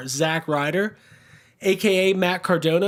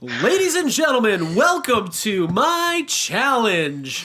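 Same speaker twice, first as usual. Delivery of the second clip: wrestling announcer voice; 9 semitones higher, 3 dB louder than usual. Pretend. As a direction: announce it to the whole arena. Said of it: "wrestling announcer who just got fed up".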